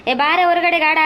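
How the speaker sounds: loud, insistent holler; impatient shouting. Acted. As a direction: yell for a neighbour to hurry out. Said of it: "ಅದ್ಯಾಕ್ ಬೇ ಅಷ್ಟ್ ಕೂಗಾಡ್ತೀಯ".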